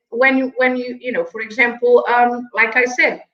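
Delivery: usual speaking voice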